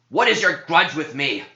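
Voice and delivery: high, loud taunting demon voice; mocking demon. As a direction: sneer it at the priest. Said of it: "he asked with mocking tone".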